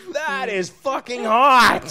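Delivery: loud, high, drawn-out exclamation; excited outburst. Real, not acted. Host reacting to guest's witchy voice, loud excited outburst. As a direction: blurt it out, thrilled and laughing.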